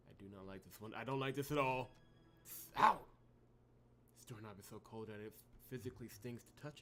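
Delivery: soft, low, uneasy in-character reading; game protagonist voice. Acted. Player voicing the heroine exploring a frozen floor, soft and uneasy. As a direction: voice the wary hero exploring the floor.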